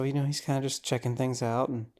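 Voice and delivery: ordinary talk, nothing marked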